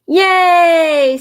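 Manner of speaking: high, loud yay; happy cheer. Acted. Cassie cheering that Marley can stay, high and loud. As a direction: cheer with delight. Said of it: "said Cassie".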